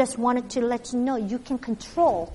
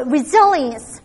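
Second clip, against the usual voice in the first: ordinary delivery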